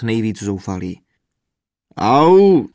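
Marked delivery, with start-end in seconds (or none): 2.0-2.7 loud drawn-out yelp of pain; sudden bellowed ouch; pained roar. A narrator's reading. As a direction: roar it out, swooping up. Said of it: "zařval bubeník".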